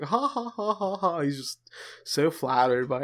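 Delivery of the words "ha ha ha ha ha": high, self-satisfied mock laughter; smug laugh. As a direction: cackle like you're flattered by everything. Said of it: "'Cause he's, he's like".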